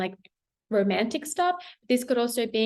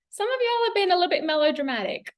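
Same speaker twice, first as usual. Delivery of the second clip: amused teasing; 9 semitones higher, faster than usual. Real, not acted. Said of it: "I just heard that".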